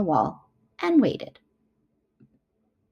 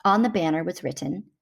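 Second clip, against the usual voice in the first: plain reading voice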